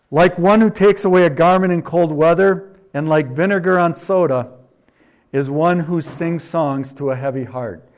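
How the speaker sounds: loud, ringing scripture reading; booming pulpit reading; preaching at full force. Acted. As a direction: proclaim it to the congregation. Reads proverb at full force.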